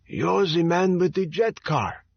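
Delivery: put-on recognising him, in a thick Eastern European accent. A narrator's reading. Teller recognising the jet car man, Eastern European accent. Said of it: "his voice thick with an Eastern European accent".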